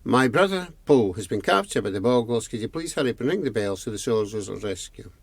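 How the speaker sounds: message run together, speeding up; gabbled message. Acted. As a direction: rattle the plea off, hard to follow. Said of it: "Could you speak in English? I can't understand".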